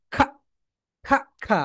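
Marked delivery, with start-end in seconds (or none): none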